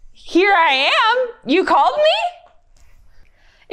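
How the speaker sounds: put-on very high, slow, childlike voice with a laugh; Samuel's line. Storyteller voices young Samuel, high and slow with a laugh. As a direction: squeak it like a sleepy little boy. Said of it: "he said".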